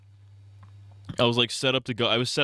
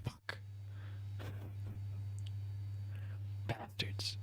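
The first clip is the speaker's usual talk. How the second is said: whispered, breathy swearing; muttered curse; 18 dB quieter, slower than usual. Retold or imitated. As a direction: hiss the curse under your breath. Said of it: "I'm like".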